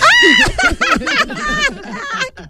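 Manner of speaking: long high laughing squeal. Real, not acted. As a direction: burst into a shrieking, high-pitched laugh. Host cackles at caller.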